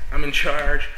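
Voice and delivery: ordinary talk, nothing marked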